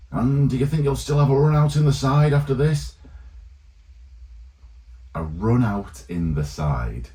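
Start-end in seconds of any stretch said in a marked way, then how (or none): 0.1-2.9 much higher and louder, a hammed-up broadcaster voice; mock commentator. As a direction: ham up the pundit firing his question. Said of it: "one of the lines that he said was".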